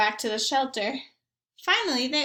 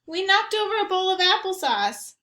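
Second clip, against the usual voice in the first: usual speaking voice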